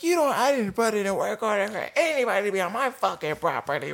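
high, indignant mimicry without shouting; mock a complaining woman in a high voice; imitated irate woman. Resident denying any work order, high mocking imitation.

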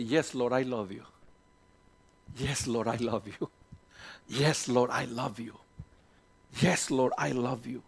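quiet, devoted answer repeated; hushed confession of love to the Lord; soft prayerful reply. Acted. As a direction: murmur it like a prayer.

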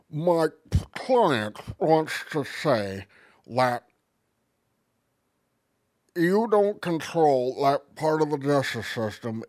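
slow, drawn-out put-on lawyer. Lawyer answering the cops for a client, slow put-on lawyer voice. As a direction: play a ponderous fat lawyer, word by word. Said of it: "lawyer voice".

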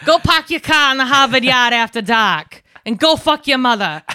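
loud, hammed-up Boston with dropped r's; Boston accent. Shown as a demonstration. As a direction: drop every r like a caricature Bostonian. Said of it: "let me do my Boston accent".

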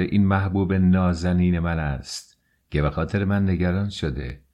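ordinary delivery

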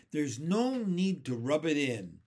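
cross, emphatic storybook complaint; snappish. A narrator's reading. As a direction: snap it as a wounded earthworm. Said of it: "snapped the earthworm".

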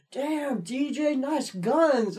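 high, leering compliment; admiring coworker. Retold or imitated. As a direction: ogle him in a high voice. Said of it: "he's like".

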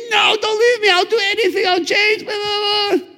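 very high mock-crying wail; desperate pleading. Girl begging the man to keep her, hammed-up desperate crying. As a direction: beg hysterically, dissolving into imitated sobbing babble. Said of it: "she's like".

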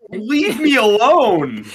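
high, loud, slow pleading; whiny victim voice. Pretend. Voicing the victim's reaction.

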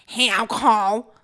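put-on slow taunting kid voice; drawn-out mocking call; imitating a bully. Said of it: "he'd be like".

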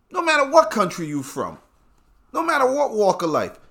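can't believe that name; incredulous high rant. Acted. Ranting incredulous.

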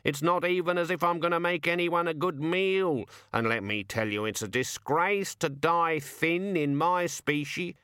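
a put-upon raven moaning; grumbling raven. Acted. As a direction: raven grousing to the Death of Rats. Raven continues.

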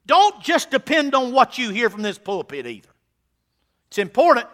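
loud, high, fervent pulpit preaching; preaching at full force. Acted. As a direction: drive it home to the congregation.

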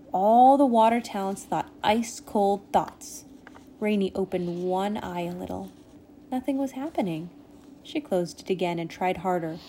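ordinary delivery

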